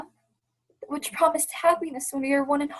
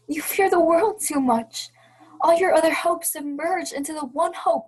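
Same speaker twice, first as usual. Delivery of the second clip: pained reproach; 2 semitones higher, 6 dB louder than usual. Acted. Belle reproaching Ebenezer.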